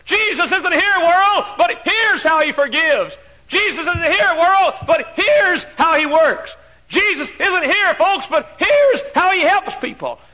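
high, loud, slow pulpit cry; shouting the message to the world; preaching shout. Acted. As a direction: call it out to the whole world. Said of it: "somebody to say".

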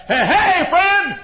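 calling out. Acted. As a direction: call out to wake a neighbour. Calling to sleeping friend at night.

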